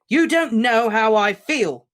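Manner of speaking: furious slow roar. Acted. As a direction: roar it at him. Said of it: "Harry roared".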